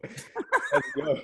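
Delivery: high, laughing cheer; delighted laughing. Real, not acted. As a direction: laugh it out in celebration. Delighted at offer of a design.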